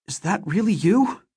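hardly daring to believe it; stunned disbelief. Acted. Mother sees husband alive.

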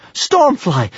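high cry of relief; relieved cry. A narrator's reading. Hiccup spots his dragon friend, crying out in relief. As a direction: call the name out, high and relieved. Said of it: "cried Hiccup in relief".

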